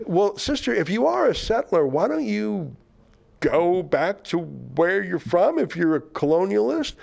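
high-pitched, pointed comeback; sarcastic retort. Real, not acted. Host answers a self-declared settler, sarcastically.

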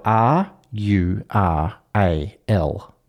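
R U R A L one letter at a time, spaced slowly; spelled out. Shown as a demonstration. Host spells the hated word for the table, spelled out. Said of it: "that word is spelled".

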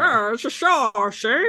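slow, drawn-out gangster imitation; cartoon mobster. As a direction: stretch the words like a cartoon mobster. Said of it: "like the mobster who always said".

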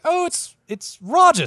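high, breathless mother voice; a lighter echo of the mother's high cry; hopeful mother. Retold or imitated. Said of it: "she's just like".

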